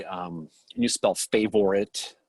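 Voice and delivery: normal talking voice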